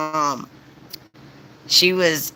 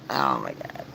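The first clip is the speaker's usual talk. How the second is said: ordinary delivery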